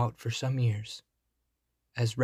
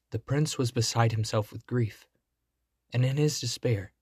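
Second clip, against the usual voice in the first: ordinary delivery, nothing marked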